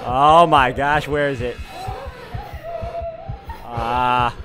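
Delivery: normal talking voice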